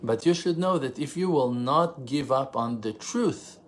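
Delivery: usual speaking voice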